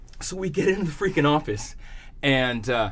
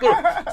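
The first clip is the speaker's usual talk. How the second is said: imitating a groan; 14 semitones higher, 5 dB louder than usual. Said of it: "He's just like".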